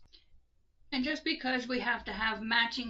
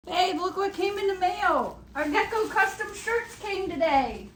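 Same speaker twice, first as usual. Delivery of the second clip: excited; 9 semitones higher, 5 dB louder than usual. Real, not acted. Excited shout, package arrives.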